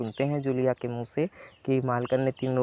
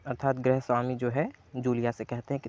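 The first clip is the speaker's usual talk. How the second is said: ordinary delivery, nothing marked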